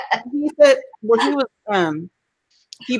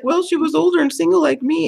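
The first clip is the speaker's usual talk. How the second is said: mom's high sweet reasoning; 9 semitones higher than usual. Retold or imitated. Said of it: "She's like".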